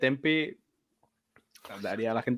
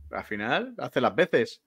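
usual speaking voice

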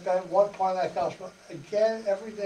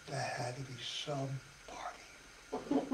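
hushed aside; 5 semitones lower, 14 dB quieter, slower than usual. Real, not acted. Awed aside about wine.

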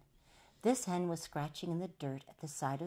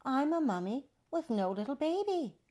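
high, louder lady's voice; 9 semitones higher, 5 dB louder than usual. A narrator's reading. Lady telling the baby she is childless, high voice. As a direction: declare it high and clear. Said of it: "said the lady".

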